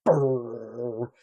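low droning foghorn blare. Retold or imitated. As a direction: imitate a low foghorn blast. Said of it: "blats out this, like, foghorn sound".

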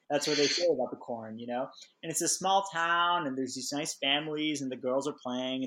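ordinary delivery

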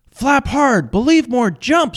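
very high, urgent coach shouts; life coach. Retold or imitated. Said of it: "says the motivational speaker".

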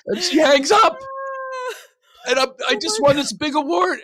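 high, loud comic crying shout; mock wail. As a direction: wail it like a wronged child, hammed up. Mock wail at being hung up on.

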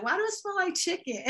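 high, laughing through the words; laughing question. Retold or imitated. Mimicking confused newcomers, high and laughing. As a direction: ask it while cracking up. Newcomers asking about smell.

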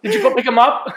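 teasing mock interrogation, breaking into laughter; joking through laughter. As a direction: pile on the absurd question with a laugh. Joking about excessive follow-up questions.

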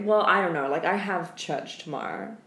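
normal talking voice

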